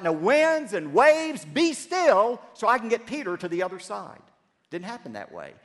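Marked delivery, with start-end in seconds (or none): 0.0-2.4 slow, loud, high command to the storm; commanding yell. Acted. Jesus commanding the wind and waves, slow loud yell. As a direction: order the storm to stop. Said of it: "Jesus yelled out".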